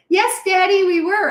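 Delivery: put-on high, sweet child answering Daddy; high little-girl reply to her dad. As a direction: play yourself as a small girl. Child self voiced in story.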